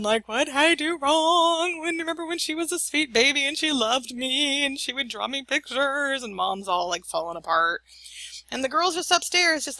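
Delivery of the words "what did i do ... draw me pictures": higher, hammed-up wail of a hurt mother; mock crying. Mom crying in the kitchen after a fight, mock wail, higher. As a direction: wail like a mom falling apart. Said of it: "crying in the kitchen".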